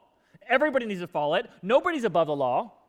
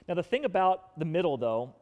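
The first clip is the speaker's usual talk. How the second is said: ordinary delivery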